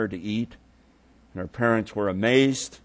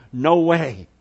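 loud, rising cry of disbelief; inner scream; 4 semitones higher, 5 dB louder than usual. Retold or imitated. Father hearing his daughter died, loud denial. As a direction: start the protest loudly. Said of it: "Everything in him would scream".

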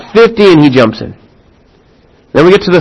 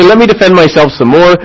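normal talking voice

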